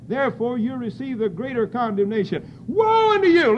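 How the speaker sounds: loud, high preaching shout on the woes; full-force preaching. Acted. Preacher hurling Christ's woes at the congregation, full-force preaching. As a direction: thunder the woes at the church.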